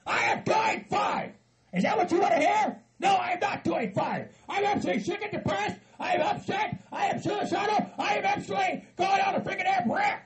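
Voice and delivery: high-pitched shouted rant; riled up. Acted. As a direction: rant at the top of your register. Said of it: "You're getting all riled up".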